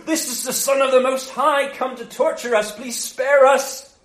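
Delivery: high, loud, frightened begging; mock panicked pleading. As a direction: plead for mercy as a terrified demon. Demons acted begging Jesus for mercy.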